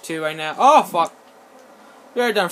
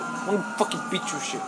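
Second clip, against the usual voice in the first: ordinary talk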